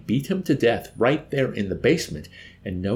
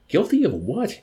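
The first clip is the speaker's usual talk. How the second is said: high, pleading question; imploring; 9 semitones higher, 4 dB louder than usual. A narrator's reading. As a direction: plead in bewilderment, pitched up. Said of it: "implored the chaplain".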